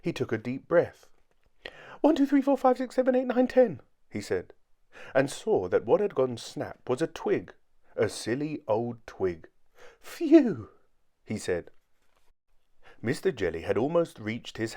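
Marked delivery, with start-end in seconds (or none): 2.0-3.8 very high, loud squeaky count; panicked counting. A narrator's reading. Mister Jelly counts to ten after the twig, squeaky panic. 10.0-10.7 high, loud squeaky phew; relieved. A narrator's reading. Mister Jelly sees it is only a twig, relieved.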